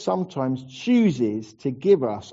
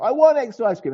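higher, louder mock tantrum; whining child; 8 semitones higher, 5 dB louder than usual. Parent mimicking a child's ice cream tantrum, mock whine. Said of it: "there's a big tantrum about it".

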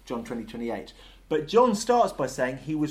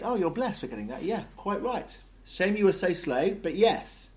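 higher-pitched, lightly acted approval; Jesus; 7 semitones higher than usual. Jesus accepting Thomas's late worship, approving. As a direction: concede it with lively, lilting assurance. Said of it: "Jesus, though, he goes".

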